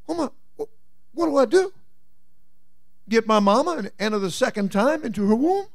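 high, cracking disbelief; baffled Nicodemus. Acted. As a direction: squeak it out, totally confused.

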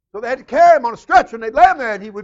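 loud, fast preacher's drive; preaching at full force. Acted. Preacher tells congregation of the lame man's begging. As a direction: push the story forward hard.

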